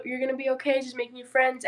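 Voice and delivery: ordinary talk, nothing marked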